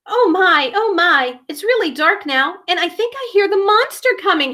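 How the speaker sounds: high, fast, fearful whimper; frightened cry. Acted. Timid Fenton fears the monster in the dark, frightened. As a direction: panic rising about the monster. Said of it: "he cried".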